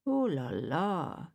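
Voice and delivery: slow, drawn-out shrug of an oh la la; shrugging oh la la. A narrator's reading. Nun giving up on the crying girl, a slow shrugging sigh. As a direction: let it out with a lazy shrug. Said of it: "shrugging her shoulders".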